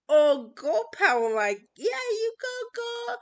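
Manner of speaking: high-pitched imitation of a cheerleading onlooker; mock cheering. Mimicking people who find a girl hitting a guy funny, mock cheering. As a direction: cheer in a squeaky, put-on high voice.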